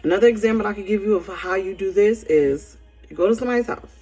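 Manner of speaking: ordinary talk, nothing marked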